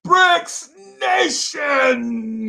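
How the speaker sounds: very high, very slow drawn-out call; stretched hype shout. Real, not acted. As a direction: stretch it like an arena announcer. Hyping his fan base.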